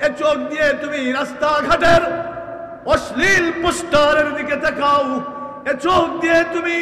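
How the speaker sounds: high, loud sung rebuke; half-chant the warning with full force; fiery preaching. Acted. Preacher rebuking young men for looking, loud and sung.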